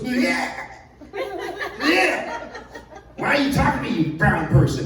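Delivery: high, hammed-up angry yell; mock an outraged man snapping at a stranger; imitated hostile tourist. Lost tourist rebuffing the man offering help, mock angry yell.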